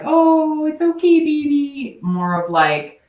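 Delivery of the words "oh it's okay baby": put-on high, loud, babying coo; mock-soothe like an overdoting partner; coddling sweetheart voice. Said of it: "And not like".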